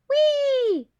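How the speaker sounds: high loud squeal of delight; gleeful whee. Acted. Squirrel leaping into the hole, squealing with delight. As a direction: shriek with joy as you leap.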